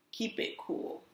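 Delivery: hushed whisper. A narrator's reading. Teacher whispering the lesson to kids. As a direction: whisper it like a secret.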